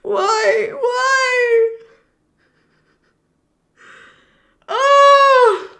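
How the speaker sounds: high, loud lamenting cries; heartbroken wail. Real, not acted. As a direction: wail in real anguish. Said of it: "Oh, my heart!".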